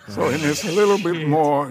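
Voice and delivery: normal talking voice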